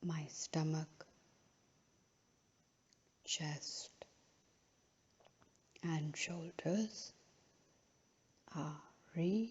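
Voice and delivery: unhurried, measured phrases, each left to settle; guided relaxation. Real, not acted. Yoga teacher guiding a final relaxation in Shavasana, slow and calm.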